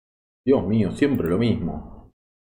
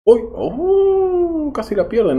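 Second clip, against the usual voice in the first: sudden high yelp of shock; startled cry; 5 dB louder, much slower than usual. Real, not acted. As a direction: jump at the near miss, pitch way up. Near miss shock.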